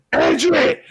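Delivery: high, loud alarm call; retold warning shout. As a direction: shout a sudden warning to the whole room. Mate warns everyone mum is coming.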